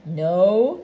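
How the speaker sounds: lightly disguise your voice to deny it's you; a hint of a false voice, starting low; slightly disguised voice. A narrator's reading. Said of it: "in a different sort of voice this time".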